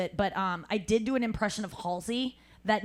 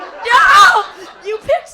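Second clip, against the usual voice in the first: much higher, louder, slow; disbelieving cry; 12 semitones higher, 18 dB louder, much slower than usual. Retold or imitated. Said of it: "exasperated reaction retold".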